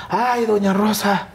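high, drawn-out imitation of a caller; distressed caller. Caller tells doña Rosa the plane is missing, imitated high lament. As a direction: wail the news in a high mimicked voice. Caller breaks bad news to mother.

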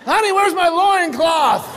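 high, drawn-out call; mock call across the house; calling to wife. Acted husband calling to wife.

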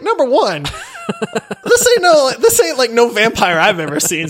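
high, loud protest breaking into laughter; incredulous, high-pitched and cracking up; laughing objection. Retold or imitated. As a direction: protest through laughter, voice shooting up. Retells own laughing objection.

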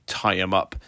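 low, belly-deep mock command; deep-voiced thug. The tough guys giving an order, in a mock low belly voice. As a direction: growl it lightly like a hired tough. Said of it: "they spoke with very deep voices".

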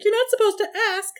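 high prissy rich-lady voice; hammed-up posh shopper. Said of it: "does that rich lady face".

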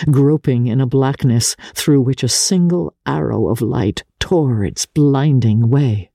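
ordinary delivery, nothing marked

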